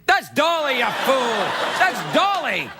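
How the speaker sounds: high shouted mock rebuke; mock scolding shout. Host yelling at crew over Dolly photo, hammed-up. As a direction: yell at them for the wrong picture.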